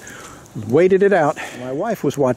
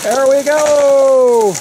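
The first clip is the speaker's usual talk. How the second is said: drawn-out high cheer as the tree falls; real thrill as the tree finally drops; excited cheer; 12 semitones higher, 5 dB louder, much slower than usual. Real, not acted. Watching the tree finally fall.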